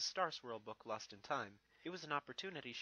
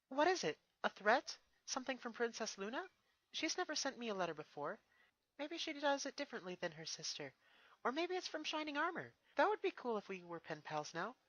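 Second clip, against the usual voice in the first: higher, lightly voiced young mare; a thoughtful pony princess, pitched up; 11 semitones higher than usual. Twilight answers Spike, lightly voiced and higher.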